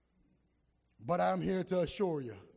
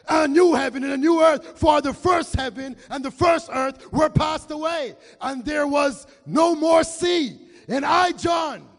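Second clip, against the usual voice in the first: preaching at full force; 8 semitones higher, 12 dB louder than usual. Real, not acted. Preacher reading Revelation to the church, full-force shouting. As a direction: thunder the verse out.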